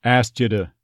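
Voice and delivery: "to" said with a D sound; flapped to. Shown as a demonstration. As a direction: soften "to" to "da". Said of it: "to almost became more of a D sound".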